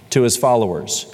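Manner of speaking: ordinary talk, nothing marked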